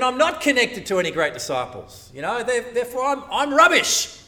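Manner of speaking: rising to a loud mock wail; mock self-pity. Voicing a self-pitying believer.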